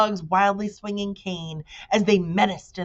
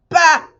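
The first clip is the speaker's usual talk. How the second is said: much higher, loud bah; scornful bah; 12 semitones higher, 12 dB louder than usual. A narrator's reading. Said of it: "said the bug".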